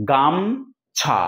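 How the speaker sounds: গামছা drawn out slowly as gam-chha; two-syllable pronunciation. Shown as a demonstration. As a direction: split গামছা in two, closing the first syllable on m. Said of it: "তিন ভাগে ভাগ করতে পারছি না".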